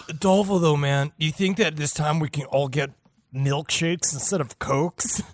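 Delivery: a meek cultist begging the boss; pleading underling. Pretend. Playing a cultist asking the boss.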